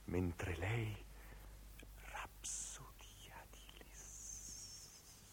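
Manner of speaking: very slow, drawn-out whisper; dying whisper. Acted. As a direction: whisper it away to nothing. Dying close of the recitation. (sussurro lentissimo che svanisce)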